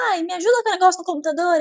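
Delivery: mock whiny kid. Daughter asking for help.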